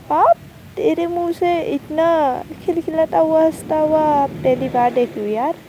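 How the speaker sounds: emphatic friendly ribbing; teasing disbelief. Acted. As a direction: exclaim it in mock astonishment. Friend teases about unusual laughing.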